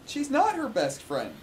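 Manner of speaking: high, loud cut-in; loud objection. Real, not acted. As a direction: shout the correction over him. Friend cuts in, contradicting.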